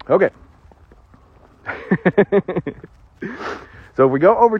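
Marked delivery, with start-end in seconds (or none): 1.7-2.7 real little run of giggles. Real, not acted. Preacher teaching on sin and death, real nervous giggle. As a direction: let a quick giggle slip out. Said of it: "I'm sorry about giggling".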